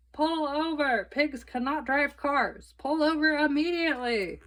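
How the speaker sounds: bullhorn shout. A narrator's reading. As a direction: order the car to stop through a bullhorn. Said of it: "Again, Officer Tomalolo shouted into his bullhorn".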